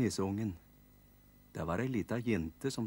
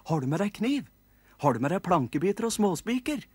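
higher, louder whiny pestering; nagging nisse kids; 9 semitones higher, 5 dB louder, faster than usual. Acted. Kids tugging at the carpenter, higher whiny nagging. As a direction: pester him like impatient little elves. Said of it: "gnålte nisseunga".

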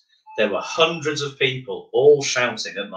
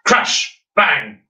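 usual speaking voice

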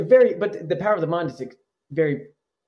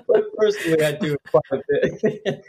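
laughing; 2 semitones higher than usual. Real, not acted. Guest answering about personal change after joking, laughing. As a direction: talk through a real chuckle.

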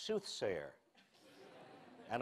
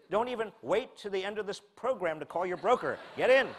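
mock bullish forecaster; 6 semitones higher than usual. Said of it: "He was saying".

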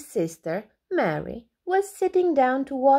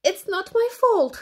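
defensive; 10 semitones higher, 4 dB louder than usual. A narrator's reading. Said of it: "said Alby".

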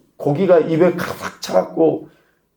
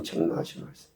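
soft whispered echo of 책망하시는 말씀; hushed whispered repeat for emphasis; 16 dB quieter, faster than usual. Shown as a demonstration. Preacher repeats key phrase.

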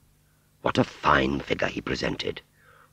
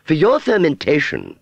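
high, loud, self-important retort; haughty; 13 semitones higher, 6 dB louder than usual. Acted. Aunt Sally justifying herself to Wurzel, haughty. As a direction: put him down grandly, voice pitched high. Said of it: "said Aunt Sally haughtily".

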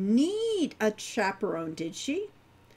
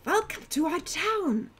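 cheerful; 5 semitones higher than usual. A narrator's reading. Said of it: "the girl said cheerfully".

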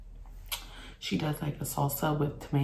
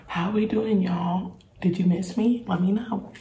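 excited; 2 semitones higher, 5 dB louder than usual. Real, not acted. Greeting the viewers again, upbeat.

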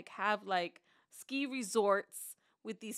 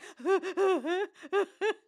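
higher, louder laughing sob; hysterical laugh-cry; 9 semitones higher, 8 dB louder than usual. Retold or imitated. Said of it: "hysterical crying".

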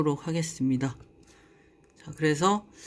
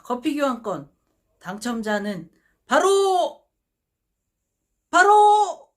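building to a huge high shout; booming prize announcer; 9 semitones higher, 10 dB louder than usual. Acted. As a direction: drag out the reveal, then explode. Drawn-out winner reveal build-up.

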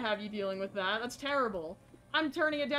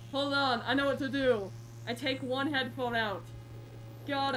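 normal talking voice

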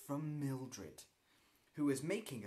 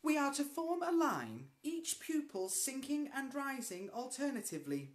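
prim recital; 12 semitones higher, 5 dB louder than usual. Acted. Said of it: "replied Ethel, word perfectly".

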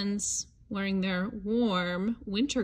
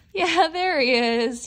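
excited discovery; 4 semitones higher, 6 dB louder than usual. Acted. Finding the hidden bear.

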